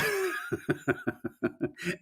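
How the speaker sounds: soft high giggle; quiet real giggle; high laugh. Real, not acted. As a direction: let a breathy giggle slip out. Breaks into laughter.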